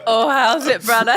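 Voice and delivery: Hawaiian Pidgin greeting, shouted high. Pretend. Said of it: "Do you speak Pidgin?".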